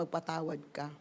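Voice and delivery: soft, low urging to forgive; God's gentle insistence, lightly acted; quiet command. Acting God's quiet repeated command.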